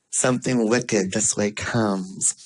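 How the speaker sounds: slow, low, lightly spooky quote; playfully ominous. Pretend. Motivational speaker teasing listeners that it's all happening, playfully ominous. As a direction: draw it out as a mock omen.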